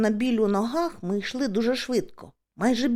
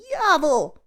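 loud, higher call; raised searching shout; 6 semitones higher, 6 dB louder than usual. A narrator's reading. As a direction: holler for a missing friend. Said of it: "гукаю нарешті".

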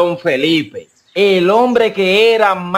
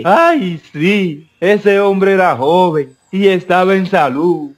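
put-on voice of a widow answering; acted widow in a joke; widow's reply. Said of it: "Y la mujer le responde".

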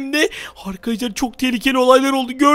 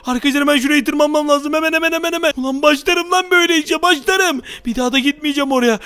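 ordinary talk

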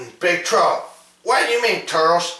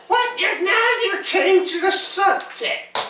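high toy voice; 14 semitones higher than usual. Pretend. Kid voicing a toy character.

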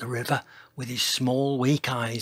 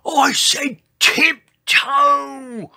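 very high, long-drawn bellow; yell; 13 semitones higher, 8 dB louder, much slower than usual. A narrator's reading. Dinosaur scolding the noisy dancer, drawn-out high yell. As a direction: yell it out, stretching each word. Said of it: "yelled George".

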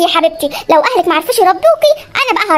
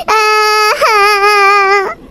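put-on baby cry; much slower than usual. Baby crying again.

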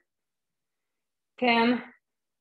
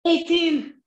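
ordinary talk

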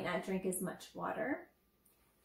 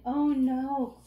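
louder, worried wail; 4 semitones higher, 8 dB louder than usual. Acted. Shark fearing the dentist, a worried louder oh no. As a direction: let the worry rise. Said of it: "Clark was worried".

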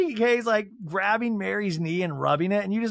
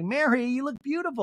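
higher gushing compliment; Monica compliment; 9 semitones higher than usual. Retold or imitated. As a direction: lift the voice up to flatter. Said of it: "And Monica's like".

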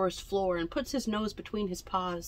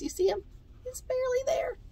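high wonder; 3 dB quieter, faster than usual. A narrator's reading. Pointing to hidden figure.

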